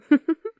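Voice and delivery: a quick amused laugh at her own tease; short laugh. Acted. Demon laughing after teasing his human date about guts.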